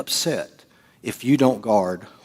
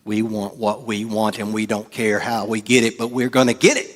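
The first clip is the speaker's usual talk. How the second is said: usual speaking voice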